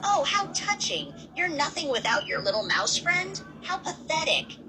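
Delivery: drip fake pity on him; mocking. Monster mocking the grieving rabbit, sneering high. Villain mocks Oswald's grief.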